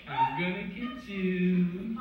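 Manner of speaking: slow, drawn-out ghostly moan; haunt the room with a slow creepy moan; spooky ghost voice. Retold or imitated. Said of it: "all of a sudden I hear".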